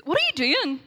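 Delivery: high, loud retold outburst; startled roommate's demand. Roommate catches her kneeling on the floor, loud startled demand. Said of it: "all I hear is".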